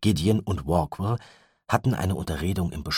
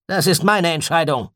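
higher, louder gruff retort; 12 semitones higher, 8 dB louder than usual. A narrator's reading. Gideon rebuffs Walkwell, gruff and loud. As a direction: bark the decision, brusque. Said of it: "sagte Gideon unwirsch".

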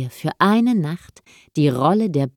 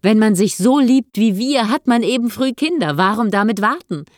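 high, delighted reassurance; joyful; 6 semitones higher, 5 dB louder than usual. A narrator's reading. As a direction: sweep the worry away happily, pitch up. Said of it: "umarmte sie freudig".

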